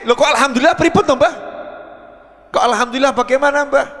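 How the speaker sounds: high, quick, puzzled protest; baffled woman's question. Acted. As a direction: act a bewildered woman questioning the elder. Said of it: "si ibu nanya ini". (bertanya heran, cepat dan tinggi)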